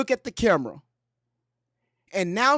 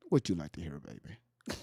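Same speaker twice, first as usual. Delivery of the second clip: much lower, softer smooth radio host; late night radio DJ voice; 11 dB quieter than usual. Retold or imitated. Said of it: "late night radios".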